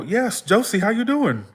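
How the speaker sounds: high-pitched, lilting hello to a relative; retold eager greeting. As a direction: imitate a pleased greeting, pitched well up. Retold greeting to great-aunt in dream.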